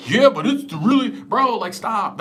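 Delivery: a whiny bro protest; mock whiny arguer. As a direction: imitate a pushy commenter. Mocking arguing commenter.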